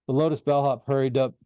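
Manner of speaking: plain reading voice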